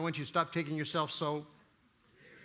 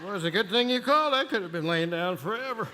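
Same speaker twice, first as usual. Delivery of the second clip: sarcastic; 4 semitones higher, 4 dB louder than usual. Retold or imitated. Groggy friend's sarcasm.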